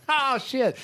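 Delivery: mock thrilled. Coworkers hearing the song over the PA, thrilled. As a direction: imitate delighted office recognition. Quoting office reaction to song.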